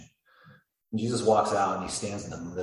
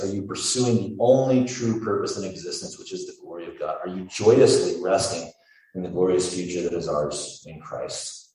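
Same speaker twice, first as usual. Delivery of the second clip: preaching at full force; 7 dB louder than usual. Real, not acted. Escalating preaching questions.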